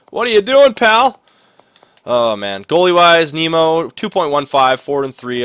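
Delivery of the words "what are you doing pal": high, loud scolding of a player; exasperated yell. Real, not acted. Gamer yelling at his struggling player, exasperated. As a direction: call him out in disbelief.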